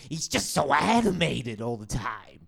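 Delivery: drawn-out over-the-top cartoon voice; cartoonishly animated. Cohost mocking an over-the-top podcaster, cartoonishly animated. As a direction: exaggerate it slowly, like a cartoon character. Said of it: "He's like a cartoon character".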